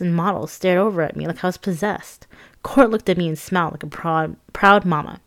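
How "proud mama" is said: usual speaking voice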